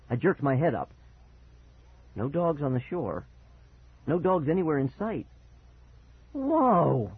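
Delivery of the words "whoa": high cry of shock; startled cry. Acted. Child sees dog reflection, cries out startled. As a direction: cry out in surprise. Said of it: "I cried aloud".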